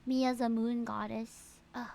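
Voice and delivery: ordinary delivery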